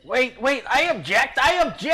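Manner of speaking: high loud frantic objection; panicked shouting. Acted. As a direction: beg loudly for life. Goblin begging for life.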